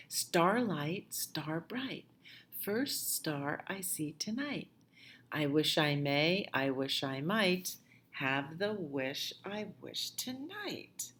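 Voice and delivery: soft rhythmic recitation; nursery rhyme chant; recited rhyme. Acted. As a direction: say the wishing rhyme gently.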